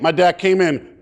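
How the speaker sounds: usual speaking voice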